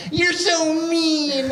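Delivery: put-on high, drawn-out childish whine; scolding little kid. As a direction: mimic an upset kid, stretching it out high. Kids reacting to classmate's fall.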